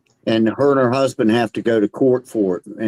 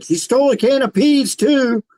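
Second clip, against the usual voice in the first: high-pitched tattling punchline; husband blurting out; 10 semitones higher than usual. Acted. Husband stands up in court to tell on wife, blurting. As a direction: pipe up high as the telling-on-her husband. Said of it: "her husband stood up in court and said".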